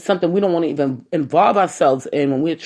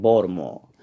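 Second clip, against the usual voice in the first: "Baltimore" as a local "Bawd-a-more"; Baltimore accent; 7 semitones lower than usual. Shown as a demonstration. Podcaster shows listeners the right way, Baltimore accent. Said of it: "the Baltimore accent".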